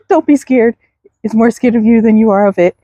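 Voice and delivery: usual speaking voice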